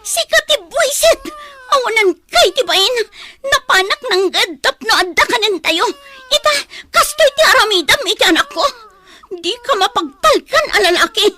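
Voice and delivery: loud, sobbing, screaming accusations; furious crying shout. Acted. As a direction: tear into him through tears. Wife screams at husband, crying. (napigsa nga ikkis a sangsangit ti nauyong nga asawa)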